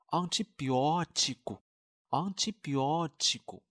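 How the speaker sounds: antibiotico said slowly, syllable by syllable, twice; slow model pronunciation. Shown as a demonstration. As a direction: spell out antibiotico slowly for students. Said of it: "不知道同学们还记不记得". (慢速示范读antibiotico)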